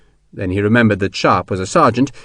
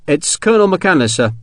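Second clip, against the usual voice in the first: higher-pitched, formal wooden report; 7 semitones higher than usual. A narrator's reading. Sergeant reporting to the general, woodenly. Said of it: "Sharp said woodenly".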